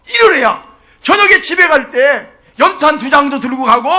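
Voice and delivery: full-force preaching. Real, not acted. Preacher pleading the jobless labourers' plight to the congregation, full force. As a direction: preach it urgently, climbing and stretching phrase ends.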